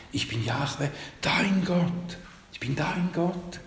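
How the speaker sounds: soft, breathy „dein Gott“, intimate; tender whisper. Shown as a demonstration. Preacher giving God's intimate words to each listener, whispered. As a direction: breathe it softly like a secret. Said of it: "Aber er sagt".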